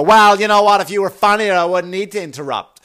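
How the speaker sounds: mock heckler doubling down on the comic; defiant heckler. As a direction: bite back at the comedian. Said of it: "then they might go".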